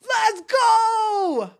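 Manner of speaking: high, loud cheer of joy; excited shout. Real, not acted. Vlogger cheering her team's draft pick, loud and high. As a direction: yell it out at the big news.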